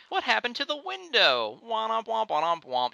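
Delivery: sing-song it like a cheesy show ending; mock sitcom parent. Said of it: "they're like".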